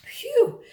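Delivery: high puffed-out phew; exaggerated tired sigh. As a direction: blow out like it was hard work. Tired after the hard work.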